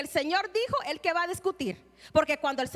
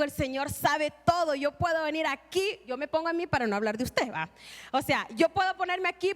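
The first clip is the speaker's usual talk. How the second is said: ordinary talk, nothing marked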